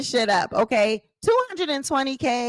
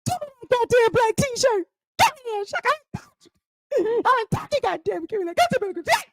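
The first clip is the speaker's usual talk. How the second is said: high, shrill-pitched squabbling voice, much higher; acted shirt quarrel; 10 semitones higher than usual. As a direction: play a woman snatching back her shirt, pitched high.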